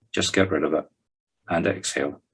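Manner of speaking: ordinary talk, nothing marked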